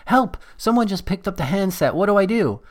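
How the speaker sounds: high, panicked little voice; panicking phone. Acted. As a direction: play a frantic, freaked-out gadget. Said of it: "the phone freaks out and says".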